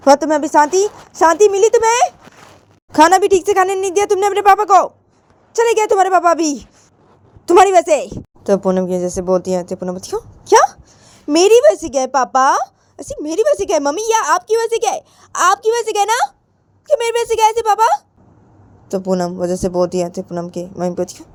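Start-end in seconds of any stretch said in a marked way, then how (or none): 0.1-8.2 high, loud reproach; accusing mother. Acted. Mother blaming daughter after papa walks out, accusing. 10.5-17.9 high-pitched disbelieving challenge; Poonam, indignant. Acted. Daughter disputing that papa left because of her, indignant.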